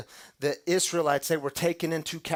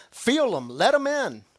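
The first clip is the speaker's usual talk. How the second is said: higher, louder invitation; urging rise; 6 semitones higher, 5 dB louder than usual. Real, not acted. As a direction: push it out with conviction. Preacher urges, rising.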